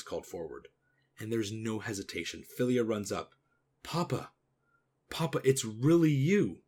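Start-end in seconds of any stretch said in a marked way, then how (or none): 3.8-6.6 higher, louder daughter's cry of recognition; acted, overjoyed reunion cry; joyful exclamation. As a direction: exclaim as if finally seeing a lost father. Daughter runs up to her father.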